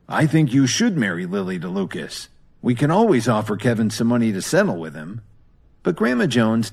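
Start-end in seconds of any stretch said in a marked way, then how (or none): none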